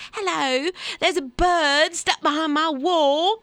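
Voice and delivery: high, slow hammed-up Cockney; Cockney accent. Host plays an English caller to wildlife rescue, Cockney accent. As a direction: put on an exaggerated, high-pitched Cockney. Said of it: "That was a Cockney accent".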